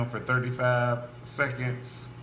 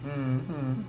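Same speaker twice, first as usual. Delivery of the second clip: ordinary delivery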